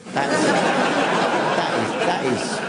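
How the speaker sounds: words stretched out through a high laugh; amused, barely able to get it out; laughing while talking. Real, not acted. Laughing through own joke.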